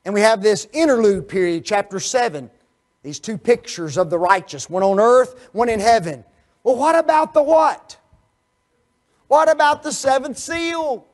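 loud, high, climbing to a peak; preaching at full force. Acted. Preacher building suspense toward the seventh seal, loud and rising. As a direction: build it like thunder to the question.

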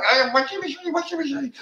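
high-pitched mimicked squeal of a thrilled toddler; excited screaming toddler. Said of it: "running around screaming".

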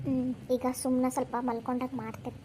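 a schemer gloating under her breath; scheming aside. Acted. Scheming aside after the act.

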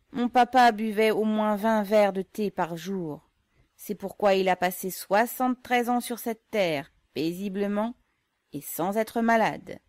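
hearty boast. A narrator's reading. Yégor hearty anecdote.

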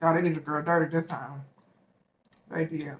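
talking mid-bite; muffled by food at lips; mouth full. Real, not acted. Seen 1: Burger held at open mouth, biting into it while speaking.

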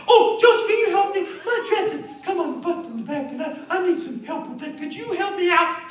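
very high falsetto, calling out sweetly to lure; hammed-up helpless woman in falsetto; coaxing temptress. Potiphar's wife asking Joseph's help with her dress, coaxing falsetto.

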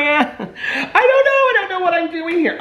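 high, slow laughter through the words; laughing helplessly. Real, not acted. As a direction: laugh it out at your own confusion. Laughing at confusing instructions.

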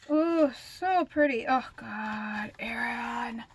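usual speaking voice

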